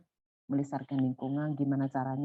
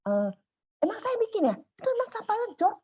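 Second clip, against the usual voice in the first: imitating a shrill, much higher angry neighbor. Neighbor scolding the speaker over the trash, mock angry and high. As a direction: snap back high and indignant, hammed-up. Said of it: "marah-marah, ngamuk-ngamuk katanya".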